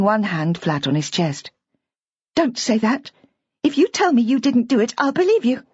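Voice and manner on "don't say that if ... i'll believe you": put-on higher, earnest young woman pleading; a young wife trying to reassure him; Bridget, higher and earnest. A narrator's reading. Bridget voiced by narrator.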